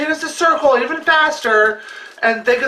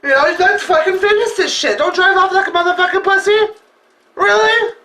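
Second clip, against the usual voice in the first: furious challenge; 8 semitones higher than usual. Retold or imitated. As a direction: scream it at the fleeing car. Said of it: "I'm like".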